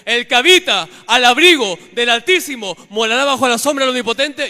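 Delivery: a psalm roared out high and very loud; shouted rebuke. Real, not acted. Preacher rebuking the demon in prayer, shouting the psalm. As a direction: hurl the verse at the demon.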